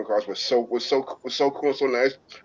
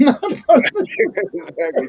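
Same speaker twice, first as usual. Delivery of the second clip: drawn-out exclaim; 13 semitones higher, 4 dB louder, much slower than usual. Real, not acted. Agreeing with a joke.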